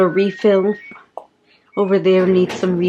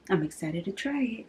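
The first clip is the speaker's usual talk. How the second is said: ordinary talk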